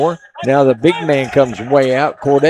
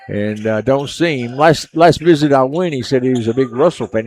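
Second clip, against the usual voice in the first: ordinary delivery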